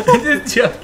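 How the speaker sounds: laughing. Real, not acted. Laughing at robot bit.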